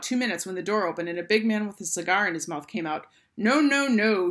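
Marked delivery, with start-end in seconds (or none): 3.4-4.3 loud, raised refusal; shouting. A narrator's reading. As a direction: shout down the idea as the boss. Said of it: "he shouted".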